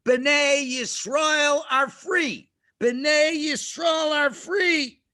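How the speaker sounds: slow, high proclamation; loud calling out. Acted. Moshe shows Pharaoh what to announce, calling out loudly. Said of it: "Call out as loudly as you can the following".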